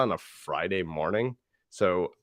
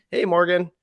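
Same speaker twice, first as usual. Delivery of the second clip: bright, higher and louder hello; friendly greeting; 9 semitones higher, 6 dB louder than usual. Shown as a demonstration. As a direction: greet him like an old buddy. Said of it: "the friendly one".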